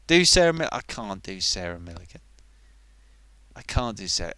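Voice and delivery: ordinary talk, nothing marked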